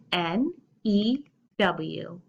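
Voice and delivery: letters spaced out one by one; spelled out slowly. Shown as a demonstration. Teacher spelling to kids, slow and clear. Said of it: "spell it with me".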